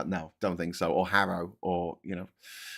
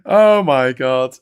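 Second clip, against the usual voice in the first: exasperated sigh; 9 dB louder, much slower than usual. Real, not acted. Exasperated after outburst.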